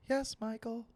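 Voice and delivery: high, soft British mom; badly faked British mum, softly replying; bad British accent. British mother answering her son, soft fake accent. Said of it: "I don't do a good British accent".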